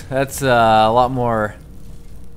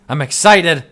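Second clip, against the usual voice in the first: louder, higher and eager; excited; 5 semitones higher, 7 dB louder than usual. Shown as a demonstration. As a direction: really sell the excitement.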